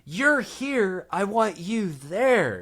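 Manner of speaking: slow, high, spelled-out directions; slow explaining. Retold or imitated. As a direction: talk slowly, as to someone who doesn't understand. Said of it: "I'm, like, trying my best".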